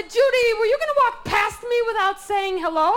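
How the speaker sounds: mock outrage at being ignored; indignant mock call. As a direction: call her out for snubbing you. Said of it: "They said".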